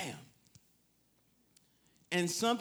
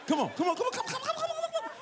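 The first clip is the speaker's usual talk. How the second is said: very high rising coaxing come-ons; pleading come on; much faster than usual. Retold or imitated. Preacher to scared driver, coaxing high pleading. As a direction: coax him over, voice climbing higher and higher.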